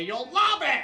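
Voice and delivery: high, loud hard sell; mock advertiser's pitch. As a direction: push the product with overblown enthusiasm. Mimics brands selling Pride products.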